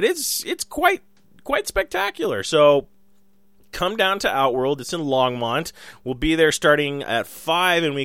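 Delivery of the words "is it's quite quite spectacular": high voice. Acted. Sudden very high voice.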